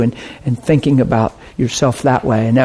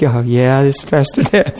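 a bit scary; 2 semitones lower than usual. Retold or imitated. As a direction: answer the phone a touch ominous. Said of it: "he sounded a little scary".

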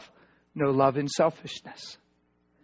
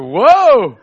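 retold whoa; 5 semitones higher, 14 dB louder than usual. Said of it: "She said".

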